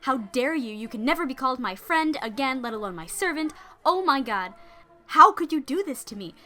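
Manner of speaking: loud, high furious tirade; explosive rage. Acted. Betrayed lady explodes at her maid, loud furious tirade. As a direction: explode at the traitor. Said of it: "She explodes".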